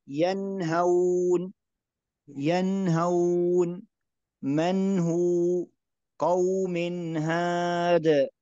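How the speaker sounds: ينهون very slow, nun clear before the ha; correct izhar. Shown as a demonstration. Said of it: "النون مظهرة". (تلاوة بطيئة بإظهار النون)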